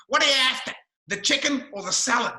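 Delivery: loud, high Aussie question; broad Australian accent. Retold or imitated. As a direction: imitate the bogan waiter demanding an order. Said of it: "he spoke with a very broad Australian accent".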